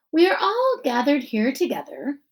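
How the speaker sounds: lightly voiced principal at a podium; principal giving speech. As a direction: address the crowd as the principal.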